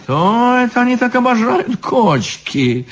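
high-pitched affected puzzlement; surprised. A narrator's reading. Elegant devil puzzled by the threats, surprised. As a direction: wonder aloud in a high, mannered voice. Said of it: "удивился изящный чёрт".

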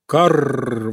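rolled, cawing raven call; wise old raven. A narrator's reading. Old wise raven suddenly has an idea, cawing. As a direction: caw it out like a crow. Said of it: "вдруг сказал старый мудрый ворон".

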